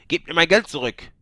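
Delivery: loud, high furious demand; seething, loud demand; angry hiss. A narrator's reading. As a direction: snap at the priest for the money. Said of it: "fauchte ihn Simachus an".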